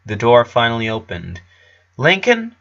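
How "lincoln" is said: put-on high, bright-eyed boy's call of the name; surprised Clyde. A narrator's reading. Clyde opening the door to Lincoln, surprised high call. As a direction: Clyde startled to see his friend.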